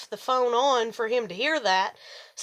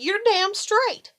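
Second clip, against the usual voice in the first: defiant retort; 9 semitones higher, slower than usual. Retold or imitated. Defiant reply to husband.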